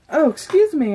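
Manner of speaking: hiccup breaking into an apology. Real, not acted. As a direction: caught by a sudden hiccup. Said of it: "I have the hiccups all of a sudden".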